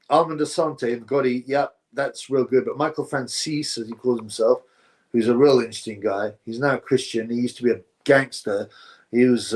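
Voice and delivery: normal talking voice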